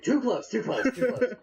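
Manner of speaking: high, laughing too close; uncomfortable squeal. Acted. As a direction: wriggle free, flustered. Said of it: "he breaks out of your arms like".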